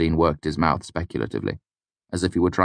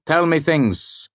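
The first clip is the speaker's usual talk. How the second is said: high, slow drawn-out call; shouted demand; 12 semitones higher, 3 dB louder, slower than usual. A narrator's reading. Lecturer demanding facts from his students, shouted. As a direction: call it out as a command, stretched. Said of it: "Elodin shouted without preamble".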